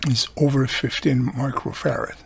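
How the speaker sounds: usual speaking voice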